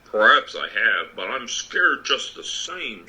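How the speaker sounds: Lion voice. Acted.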